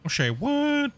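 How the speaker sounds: high, startled call of a name; surprised call. Real, not acted. Streamer calling out to his wife, surprised.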